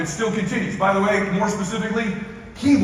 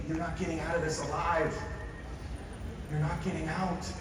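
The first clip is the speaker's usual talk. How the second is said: slow, very soft, earnest urging; a moved speaker urging the room softly; hushed appeal; 11 dB quieter, slower than usual. Acted. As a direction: lean in and plead quietly. Tender closing appeal.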